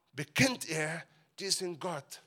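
ordinary delivery

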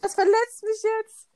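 high, slow, wounded whine; whiny hurt voice. Retold or imitated. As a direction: mimic a touchy friend's hurt whine. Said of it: "da war sie immer so".

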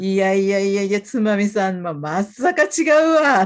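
mock Tochigi speaker, slowing and rising at the end; Tochigi local's reply. Telling a friend how Tochigi locals say まさか, in light Tochigi dialect. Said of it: "っていうの".

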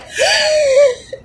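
put-on breathless high kid's scream that gets stuck; little kid gasp scream. Telling a snake dream, imitating her own high choked kid scream. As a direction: gasp like a terrified small child. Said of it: "the little kid scream".

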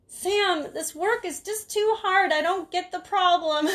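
high, whiny complaining mom; high, slow, whining yell. Retold or imitated. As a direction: whine it loudly across the house like a frustrated old mother. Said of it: "my mom would yell over the thing".

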